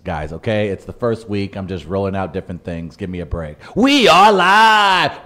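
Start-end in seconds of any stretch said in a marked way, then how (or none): 3.8-5.3 high, loud, drawn-out shout; big show open. Shown as a demonstration. Host testing openers, loud stretched hype call. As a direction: belt the show name out like a hype man. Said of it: "trying different opens".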